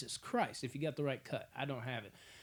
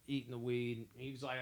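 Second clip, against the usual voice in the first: normal talking voice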